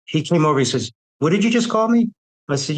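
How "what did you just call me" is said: sharp, much higher angry demand; angry challenge. Retold or imitated. Cadet on the train confronting John, angry high challenge. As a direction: square up to him, furious.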